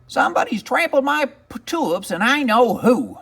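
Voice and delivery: high, cross witch voice; angry witch spotting her ruined flowers; indignant little witch. Acted. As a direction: squawk it out as a peeved witch. Witch finds trampled tulips.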